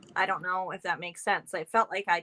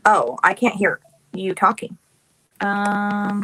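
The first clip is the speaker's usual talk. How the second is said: raised voice over bad line; louder, repeating the problem; calling out; 9 dB louder, slower than usual. Real, not acted.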